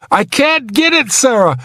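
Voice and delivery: high, desperate strain. A narrator's reading. Trapped man misses the swinging hook, desperate. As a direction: strain upward in frustration.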